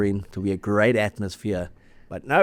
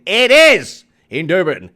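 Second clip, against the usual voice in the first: louder, slower, drawn-out hype; hyped announcement; 5 semitones higher, 8 dB louder, slower than usual. Real, not acted. Host hyping the Durban derby, loud and slow. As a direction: sell the big weekend.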